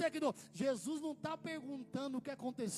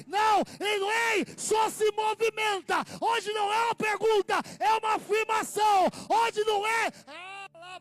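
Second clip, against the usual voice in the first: loud, higher, shouted sermon climax; full-force preaching; 4 semitones higher, 12 dB louder than usual. Acted. Preacher shouting to the congregation at full force. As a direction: preach it with all your might.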